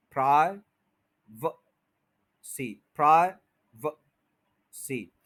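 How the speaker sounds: प्राइवेसी as "praai... vuh... see" with long gaps; syllable by syllable. Shown as a demonstration. Teacher breaks it up for learners, slow schwa demo. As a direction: break प्राइवेसी slowly, middle just "vuh". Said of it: "व बन गया है".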